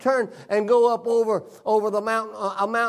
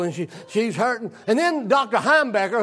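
ordinary delivery

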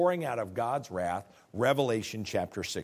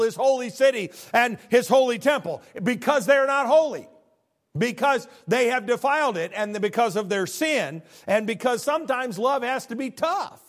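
much higher and louder impassioned preaching; preaching at full force; 13 semitones higher, 8 dB louder than usual. Acted. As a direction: thunder it from the pulpit, driving each holy. Preaching climax on holy people.

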